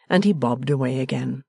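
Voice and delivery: ordinary delivery, nothing marked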